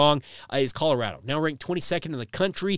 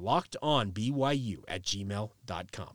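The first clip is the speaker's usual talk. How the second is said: slow soft repeat; 6 semitones lower, 7 dB quieter, slower than usual. Shown as a demonstration. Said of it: "Once again".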